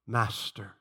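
low, soft imitation of a deep voice. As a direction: imitate his deep, reverent address. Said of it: "The way he said it with his deep voice".

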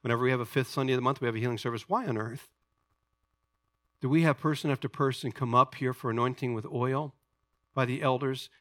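ordinary talk, nothing marked

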